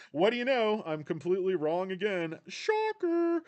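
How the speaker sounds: loud, high self-mocking exclamation; mock surprise. Real, not acted. Admitting to viewers he was wrong again, mock surprise.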